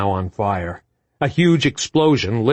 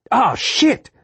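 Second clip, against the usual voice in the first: high urgent yell; 10 semitones higher, slower than usual. A narrator's reading. Marcus yelling at Sam to get out, high shout. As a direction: bark them out of the burning cab. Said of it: "Marcus yelled".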